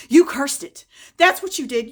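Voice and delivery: loud, high, accusing yell; angry accusation. Retold or imitated. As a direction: hurl the blame at her. Teen accusing grandmother.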